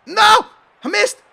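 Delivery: loud, high outcry; dismayed shout. Real, not acted. Gamer misses a chance, dismayed shouting. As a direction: yell in disbelief at the miss.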